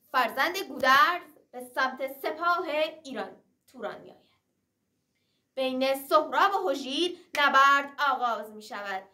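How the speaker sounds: plain reading voice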